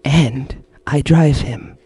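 very slow, hushed villain voice; the Creator, Kalo impression. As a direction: draw each phrase out like a possessed mastermind. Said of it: "with the Kalo impression".

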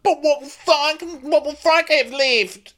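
hammed-up fretting; whiny Kyle. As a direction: plead pathetically about Frank. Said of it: "He's like".

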